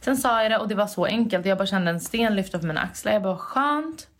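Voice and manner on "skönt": high, light sigh of relief; relieved. Retold or imitated. To herself after telling Hanna, a relieved high sigh. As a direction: let it out like a weight lifting. Retelling own relief, quoted.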